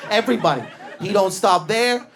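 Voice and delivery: normal talking voice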